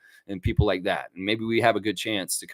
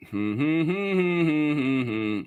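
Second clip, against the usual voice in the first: idle humming; 3 semitones higher, slower than usual. Real, not acted. Host looking into the breaking RJ rumor, idle humming. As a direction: hum that rises, then falls.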